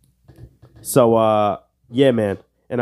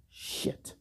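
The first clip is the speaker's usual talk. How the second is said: whispered curse; 19 dB quieter than usual. Real, not acted. Quiz reaction.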